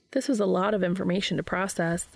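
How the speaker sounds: ordinary delivery, nothing marked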